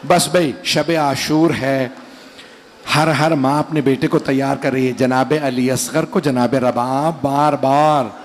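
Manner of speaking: slow, sorrowful majlis recitation, voice dropping low; lamenting recitation. Acted. Preacher reciting the martyrdom to mourners, lamenting. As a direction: mourn each line as you tell it.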